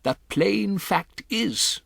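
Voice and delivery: put-on a composed old grandmother breaking odd news; grandmother's voice. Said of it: "my grandmother said".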